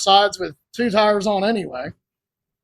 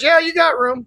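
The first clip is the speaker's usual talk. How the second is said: much higher imitation of a friend; called-back assurance; 8 semitones higher than usual. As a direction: call back a breezy all-clear, imitated. Acts out Emily answering.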